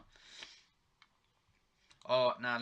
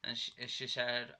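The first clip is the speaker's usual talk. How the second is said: ordinary talk, nothing marked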